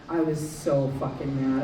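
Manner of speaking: ordinary talk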